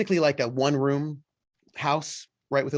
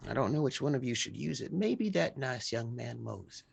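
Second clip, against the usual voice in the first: put-on softer, hushed old woman's voice; old woman musing; 7 dB quieter than usual. Hattie quietly muses over who gets the present. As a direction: weigh who should take the gift.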